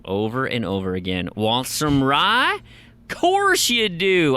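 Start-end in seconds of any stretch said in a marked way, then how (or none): 1.4-4.4 very slow, high swooping drawl; hammed-up character, question sliding way up; game character voice. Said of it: "I don't even know if that's the voice".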